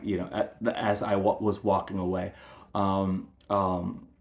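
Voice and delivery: usual speaking voice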